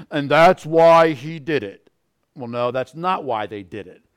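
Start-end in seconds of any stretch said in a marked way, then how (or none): 0.1-1.9 psychiatrist. Acted. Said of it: "the psychiatrist or the psychologist would say".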